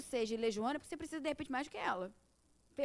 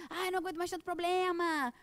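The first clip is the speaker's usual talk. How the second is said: higher-pitched, hammed-up lament; mock complaining; 4 semitones higher, 4 dB louder than usual. Speaker mimicking someone hit by problem after problem, mock complaining. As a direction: imitate someone fed up with their troubles.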